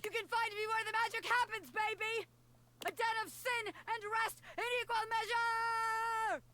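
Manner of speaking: high, shouted call over the shoulder; fleeing character shout. Acted. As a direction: yell it back while running away. Bobby shouts while fleeing.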